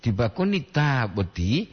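taa-boti, stretched first syllable; long double A. Shown as a demonstration. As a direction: hold the first A in "taboti". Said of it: "Dua A".

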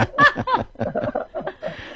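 breathy high-pitched giggle; soft airy laugh. Real, not acted. Host laughs at joke.

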